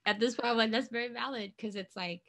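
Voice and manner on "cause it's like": ordinary talk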